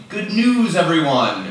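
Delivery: high, loud announcement in a put-on voice; professor's catchphrase. Adjunct professor saying what the title allows, high mock announcement. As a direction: proclaim it like a professor greeting the class.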